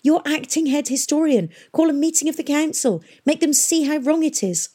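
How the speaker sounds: high, insistent plea; urging. A narrator's reading. Catherine pushes Pomeroy to speak out, urgently. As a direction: press him to take action. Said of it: "urged Catherine".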